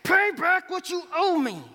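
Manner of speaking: a loud, high shout that grabs him by the throat; angry demand. Acted. Forgiven servant shouting at the debtor to pay up. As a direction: bark the debt out like you're choking him. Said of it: "and he demands".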